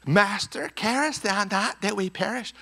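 high, soft, stilted plea; prim King James recital. Acted. Preacher mocking King James disciples waking Jesus, prim. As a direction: recite it daintily, far too politely.

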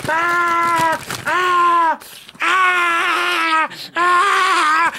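frustrated screams. Pretend. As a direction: scream like the machine just beat you. (gritos agudos de frustración fingida)

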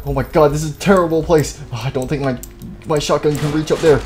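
ordinary talk

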